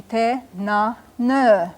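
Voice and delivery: te na ne slowly, syllable by syllable, last one held; long final vowel. Shown as a demonstration. Teacher gives plural for men, te na ne with long ending. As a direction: separate te na ne and draw out the end. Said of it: "with a long barred U on the end".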